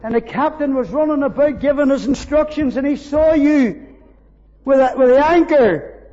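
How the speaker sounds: loud, high, rising preacher's cry; fervent preaching. Acted. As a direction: lift the room with swelling praise. Preaching at full force.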